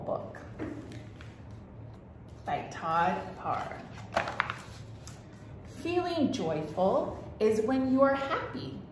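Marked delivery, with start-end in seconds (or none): none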